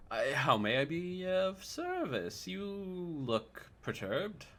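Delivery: a gracious scholar asking what's wrong, unhurried; polite old scholar. Acted. GM voices Rictavio, slow and high.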